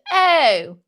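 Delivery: loud, high mock-posh "Oh"; imitating a pseudo-posh dinner guest; pseudo-posh accent. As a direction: gush it in affected posh English. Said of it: "She says".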